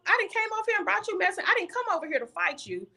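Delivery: high and fast, words tumbling out; shocked protest. Retold or imitated. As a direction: blurt it in disbelief after the slap. Retold protest after being slapped.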